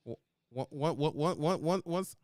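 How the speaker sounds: usual speaking voice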